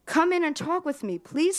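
plain reading voice

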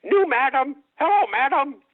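high, chirpy talking-parrot voice. Acted. Parrot greeting the new lady of the house, high parrot voice. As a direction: play the brothel parrot greeting its new owner. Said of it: "And the parrot sees her and goes".

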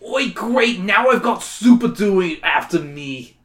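a mild hammed-up whiner; whiny Woody Allen. Robot dinosaur whining about the superhero. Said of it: "says in a whiny voice".

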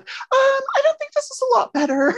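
very high, sweet princess voice; squeaky cartoon princess lilt. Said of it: "And she's like".

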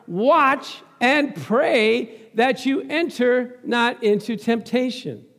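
slow, weighty scripture delivery; slow biblical intonation; slow solemn reading. Acted. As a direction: lean on each word of the verse.

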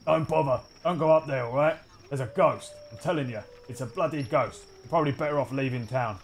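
another townsman. Acted. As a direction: warn someone off as a wary townsman. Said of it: "You hear another voice saying".

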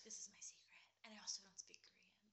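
whispered confession; whispering a pretend confession to a friend, hushed; secret whisper. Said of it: "It's like".

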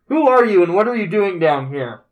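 echoing challenge from nowhere; unearthly voice. Acted. GM voices disembodied door voice.

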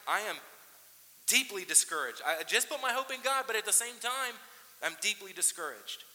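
loud, high confession of despair; earnest lament. Retold or imitated. Pastor voicing the psalmist's discouragement, loud earnest. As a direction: confess it openly from the pulpit.

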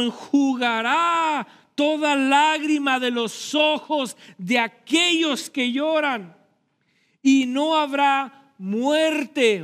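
high, loud, slow preaching with stretched words; full-force preaching. Acted. Pastor preaching full force to the congregation about tears wiped away. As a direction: preach it out, building to a cry.

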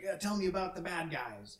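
a hint of a keen young rookie, voice lifted; green recruit. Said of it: "talking to a kid who just got out of basic training".

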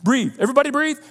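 high, quick "wait, wait"; settling a panicking crowd; mock calming. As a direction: hush the crowd in playful alarm. Calming alarmed listeners playfully.

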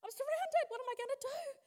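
high yelp fading to a frightened whimper; panicked yelp, then a small scared voice; mock panic. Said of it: "We go".

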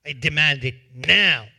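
loud, hard demand; the creditor refuses and demands payment loudly; angry refusal. Acted. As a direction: refuse flatly and insist. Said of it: "And this guy says".

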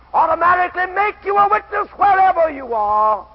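ordinary talk

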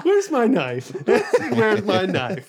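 high, laughing mock threat; mock menace. Dan to friends who interrupt, mock menace. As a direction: joke like you're reaching for a blade.

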